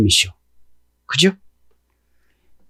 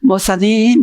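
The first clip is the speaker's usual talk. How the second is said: higher-pitched polite address, feelings held back; feigned composure; 7 semitones higher, slower than usual. Acted. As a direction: mimic someone politely hiding their feelings. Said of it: "살짝 감정을 드러내지 않으면서".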